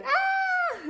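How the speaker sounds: sudden high-pitched imitated scream; mock child scream. Imitating her son's screaming outburst, high shriek. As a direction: let out the boy's angry shriek. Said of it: "like, screaming".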